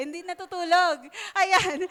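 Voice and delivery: words broken up by real laughter; giggling correction; laughing. Real, not acted. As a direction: laugh through it. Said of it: "laughs at her own joke".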